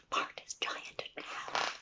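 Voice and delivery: breathy whispered ending; storyteller hushing for the kids; whispered prompt. Shown as a demonstration. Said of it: "leaves it for children".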